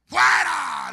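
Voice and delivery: long, high, very loud command; shouted exorcism. Retold or imitated. Pastor re-enacting casting out a witch, shouting. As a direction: bellow it as if casting out a spirit. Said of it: "entre más gritaba".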